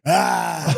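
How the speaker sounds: a sudden high wild roar, acted out. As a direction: let out a mock charging bellow. Naked man roars before attacking.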